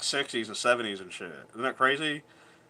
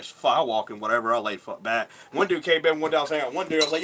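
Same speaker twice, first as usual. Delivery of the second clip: usual speaking voice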